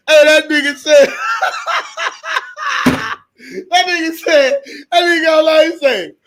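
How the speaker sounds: can barely get the words out for laughing; laughing hard. Real, not acted. Streamer retelling the letter to chat, laughing hard.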